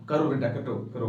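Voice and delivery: low, soft coaxing voice; encouraging parent. Retold or imitated. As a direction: gently urge the child on. (प्यार से बढ़ावा देते माता-पिता)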